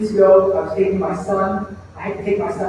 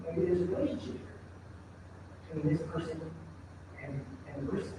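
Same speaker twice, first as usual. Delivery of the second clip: hushed, low inner thought, far softer; quiet musing; 3 semitones lower, 19 dB quieter than usual. Retold or imitated. Preacher reflecting in line at Starbucks, hushed musing. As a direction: drop to a private reflective murmur. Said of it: "I start thinking to myself".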